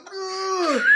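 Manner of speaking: loud, high mock groan through laughter; pained groan. Man retelling his painful massage, hammed-up groan. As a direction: groan hard, hamming up the pain. Acts out straining in pain.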